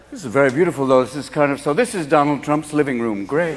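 a hint of a smug rich host; mock posh host.

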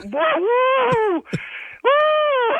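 very high shouted whoops of relief; real relieved whoop. Real, not acted. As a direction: let the shock out in a big whoop. Husband relieved after prank.

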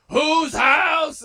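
high, loud arena announcer shout; stadium hype call. Retold or imitated. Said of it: "It's not as bad as the Rams".

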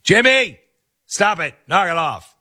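high, scolding mom voice calling out Jimmy; a mother telling her son to quit it, high and sharp. Retold or imitated. Mom scolding her son, voiced high. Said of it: "She's like".

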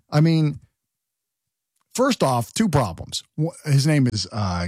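ordinary talk, nothing marked

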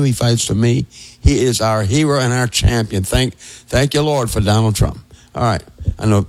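normal talking voice

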